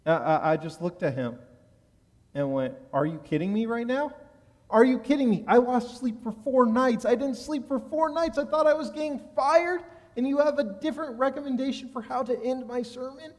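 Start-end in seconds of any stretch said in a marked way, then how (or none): 2.9-13.3 building from higher to much higher and louder disbelief; incredulous outburst. Retold or imitated. Young rabbi venting at his boss after four sleepless nights, mock outrage. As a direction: let the exasperation climb line by line.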